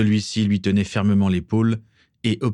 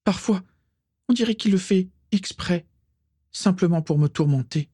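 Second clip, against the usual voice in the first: anguished complaint; 12 semitones higher than usual. A narrator's reading. Distraught Bellatrix continues her tirade.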